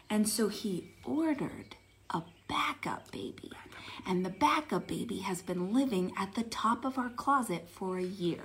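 secretive whisper. Real, not acted. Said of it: "Please keep this amongst ourselves".

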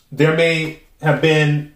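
ordinary delivery

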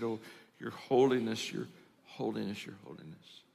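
hushed, fading to almost nothing; humbled reverence. Acted. Humbled visitor kneeling before the Pope, reverently hushed.

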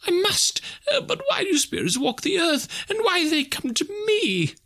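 very high, pleading voice; frightened Scrooge. A narrator's reading. Scrooge asking why spirits come to him, frightened pleading. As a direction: question the phantom in fear.

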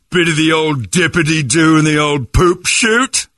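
high, slow cartoon English accent; mock British accent. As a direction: tease with a silly English accent. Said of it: "we do that too".

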